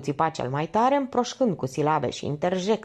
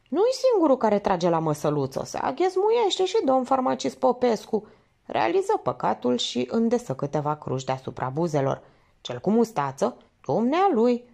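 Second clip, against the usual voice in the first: lightly voiced chatty old nun, higher; a hint of a prim nun telling tales; gossiping nun; 6 semitones higher than usual. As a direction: tattle like a pious old nun.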